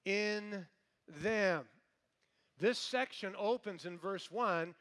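loud, emphatic preaching with punched words; full-force preaching. Acted. Preacher stresses scripture to the congregation, loud and emphatic. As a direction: drive the verse home to the congregation.

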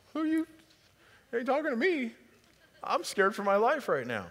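high, squeaky frightened voice; scared Gideon. Retold or imitated.

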